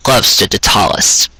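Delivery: gentle audiobook narration of a love scene; story narration. Acted. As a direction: read the tender scene like a bedtime story. Live read of a fanfic.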